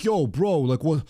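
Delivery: bro talk. Retold or imitated. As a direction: play the laid-back buddy, lightly. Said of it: "Because that's how he talks".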